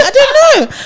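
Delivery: high, loud protest; flustered outburst. Real, not acted. Co-host challenged on the episode number, flustered outburst. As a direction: burst out helplessly, pitch shooting up.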